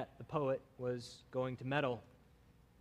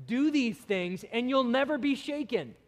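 proclaiming; 7 semitones higher, 4 dB louder than usual. Acted. Psalm's final line quoted.

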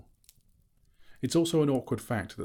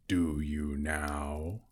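slow deep pleased drawl; flattered dragon; 3 semitones lower, much slower than usual. A narrator's reading. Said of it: "said the dragon, somewhat flattered".

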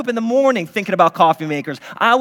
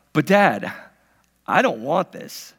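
a hint of a pleading child; whiny kid; 6 semitones lower than usual. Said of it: "kids are like".

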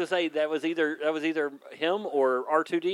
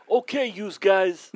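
put-on Brooklyn tough-guy accent; 4 semitones higher, 5 dB louder, slower than usual. Player in an accent fight, bad Brooklyn accent. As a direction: do a hammy Brooklyn wise guy.

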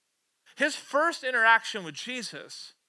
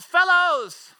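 high, loud hail across the water; calling out; 9 semitones higher, 13 dB louder than usual. Acted. Jesus calls to the disciples from shore, calling out. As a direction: shout it like a call from shore. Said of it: "he called out".

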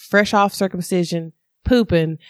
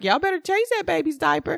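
higher, softer put-on voice of a no-nonsense aide; a dry order from the hospital aide, pitched up; bossy aide; 9 semitones higher, 4 dB quieter than usual. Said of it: "was like".

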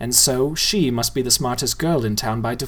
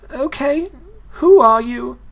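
put-on higher, strained girl near tears; 12 semitones higher, 5 dB louder than usual. A narrator's reading. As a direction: ask through coming tears. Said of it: "looked near tears".

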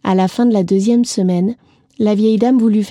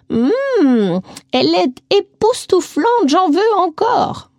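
aigu et lent, émerveillée; exclamation ravie; 8 semitones higher, slower than usual. A narrator's reading. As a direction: savoure chaque mot, ravie. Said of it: "s'exclama-t-elle". (la vieille dame s'extasie sur la tarte, aiguë et lente)

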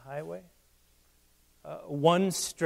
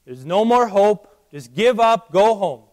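booming, much louder and higher, as death itself; 4 semitones higher, 11 dB louder than usual. Acted. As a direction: announce the loss coldly, like death taunting. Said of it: "That's death saying".

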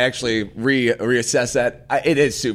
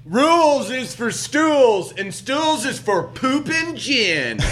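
high, drawn-out slogan yell; mock yell; 10 semitones higher, slower than usual. Co-host yells a nonsense slogan about rules, high drawn-out holler. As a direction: holler it slowly, stretching each phrase high. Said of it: "I thought that should be yelled like that".

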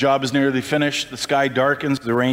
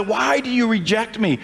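God asking; 9 semitones higher than usual. Acted. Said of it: "he now says".

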